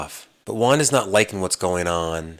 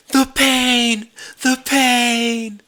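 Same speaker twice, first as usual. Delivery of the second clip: much higher, louder mock wail of pain; hammed-up agony; 5 dB louder, much slower than usual. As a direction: overplay the hurt in a high cry. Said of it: "guy's just like".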